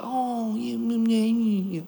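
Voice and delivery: put-on soft, hushed soothing of a crying baby; coddling. Dad retelling how he coddled his upset son, soft and soothing. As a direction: coo at him gently to comfort. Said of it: "I coddled him".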